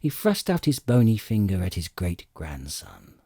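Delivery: plain reading voice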